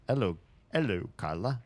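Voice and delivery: put-on French-accented hello, dropping the H; bad French accent. Streamer restarts the note from Jules, bad French accent. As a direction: try the greeting in a hammy French accent. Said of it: "Such a bad French accent".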